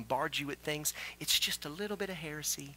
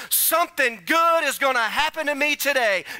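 higher, louder declaration; preached declaration; 10 semitones higher, 10 dB louder than usual. Shown as a demonstration. Preacher declaring "Something good is gonna happen to me today" to the church, loud and high. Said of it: "You ought to ... and say".

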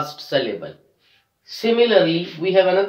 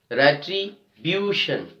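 "Retribution" slowed, broken before a stressed BU; 3 dB quieter, slower than usual. Shown as a demonstration. Teacher demonstrating the second last syllable, slow stressed "retriBUtion". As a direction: lean on the BU in "Retribution". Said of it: "We are stressing on the second last syllable".

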